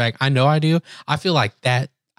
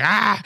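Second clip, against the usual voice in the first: short high "ah"; mock cry of pain; imitated pained yelp; 9 semitones higher than usual. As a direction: mimic a yelp under a digging elbow. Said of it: "dig my elbow into his back".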